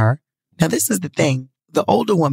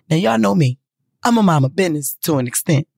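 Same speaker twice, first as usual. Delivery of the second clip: normal talking voice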